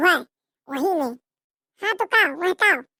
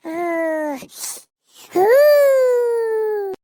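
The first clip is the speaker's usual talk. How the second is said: cold shivering groan; 3 semitones higher, 4 dB louder, much slower than usual. Acted.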